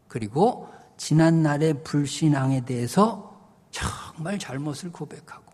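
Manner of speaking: normal talking voice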